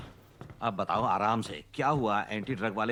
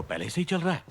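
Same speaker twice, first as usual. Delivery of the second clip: higher-pitched, astonished echo; incredulous question; 6 semitones higher than usual. Acted. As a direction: question it in disbelief. Shocked to learn of prior case.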